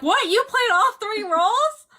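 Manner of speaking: high, loud, incredulous outburst; amazed reaction. Retold or imitated. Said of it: "people were like".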